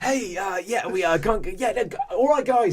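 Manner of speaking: high, laughing, flustered phone voice; caller in a toilet. Pretend. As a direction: sound caught out mid-toilet on the phone. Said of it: "you're in a toilet cubicle".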